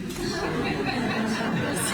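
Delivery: high-pitched real laugh. Real, not acted. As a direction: break into a laugh mid-statement. Breaks up mid-statement, then apologises.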